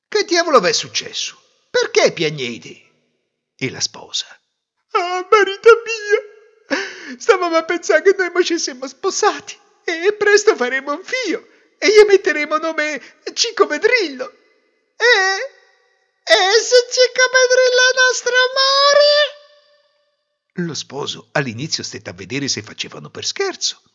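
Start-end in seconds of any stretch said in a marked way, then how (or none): 0.1-2.8 exasperated demand. Acted. Groom finds the family weeping. 4.9-20.7 high, loud hammed-up weeping; whining lament. Bride explaining to her groom, tearful wailing. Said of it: "A sentire quel piagnisteo".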